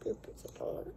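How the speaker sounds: unvoiced whispered secret. Real, not acted. Child whispering story to parent.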